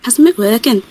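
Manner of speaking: mock regional British accent; playful attempt at a British accent; put-on British accent. Shown as a demonstration. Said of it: "call in with your accent".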